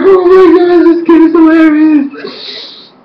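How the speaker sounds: high-pitched squeal of delight; gushing amusement. Retold or imitated. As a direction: squeal it, stretching the end. Acts out her amused reaction.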